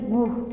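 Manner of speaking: gu said as a soft gu like a u; sound demo. Shown as a demonstration. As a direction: let gu slide into a u sound. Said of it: "entonces suena así".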